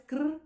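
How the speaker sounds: "cr" stranded without a vowel; división incorrecta. Shown as a demonstration. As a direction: trill "cr" alone as a broken piece. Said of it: "No suena armónico". ("cr" suelto, sin vocal)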